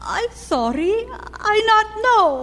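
about to cry. Acted. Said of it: "Oh, no, don't cry".

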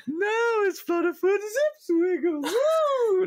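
very high, loud, crying-out jester voice; squeaky gnome jester. Retold or imitated. DM voicing the annoying gnome jester to players, shrill squeal. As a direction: shriek it like a manic gnome clown. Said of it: "like this the whole time".

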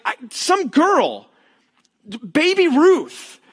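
put-on high, slow, hesitant boy; high unsure boy voice; clueless kid. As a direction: fumble for the name. Smalls unsure answer.

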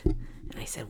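ordinary delivery, nothing marked

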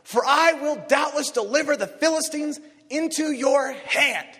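high, loud booming proclamation; voice of God. Acted. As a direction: proclaim it as God, full force. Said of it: "the Lord says to David".

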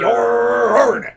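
high, loud, stretched-out holler; hammed-up bellow, drawing out the word; comic echoing shout. Host echoes co-host's yarn joke, comic shout.

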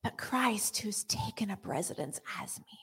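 unvoiced, hushed follow-up; whispered. Real, not acted. Preacher whispering after a shout. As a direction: drop to a whisper.

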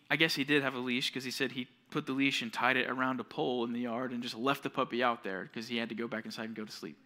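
ordinary delivery

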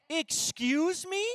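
high, drawn-out incredulous query; indignant disbelief. Retold or imitated. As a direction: balk loudly at the price, stretching it out. Balks at carnival price.

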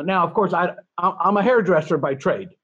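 ordinary talk

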